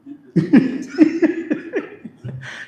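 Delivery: loud breathy laugh; burst of laughter. Real, not acted. Laughing at the question.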